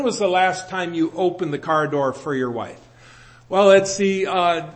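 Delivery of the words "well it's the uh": mock confession. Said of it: "No, I'm, I'm kidding".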